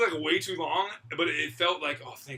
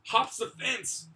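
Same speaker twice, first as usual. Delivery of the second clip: usual speaking voice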